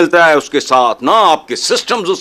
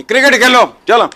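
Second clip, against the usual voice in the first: loud, high, exasperated dismissal; angry shout; 6 semitones higher, 4 dB louder than usual. Real, not acted. Host scolding the nation over cricket, angry shout. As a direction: fling the words away in disgust.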